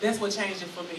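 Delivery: ordinary delivery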